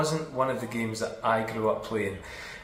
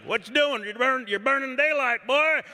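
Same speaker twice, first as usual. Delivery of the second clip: put-on higher West Texas holler, louder on boy; Texan dad hollering; 13 semitones higher, 4 dB louder than usual. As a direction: imitate a West Texas dad yelling at his lazy son. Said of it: "and be like".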